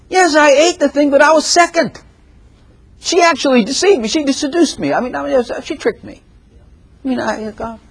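high, whiny excuse-making; Adam making excuses. Retold or imitated. Adam blaming the woman before God, high and whiny. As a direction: wriggle out of the blame.

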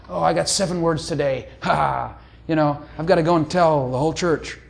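airy, self-important mystic voice; mock mystic. Preacher mocking a closet mystic, airy and smug. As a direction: parody a super-spiritual believer.

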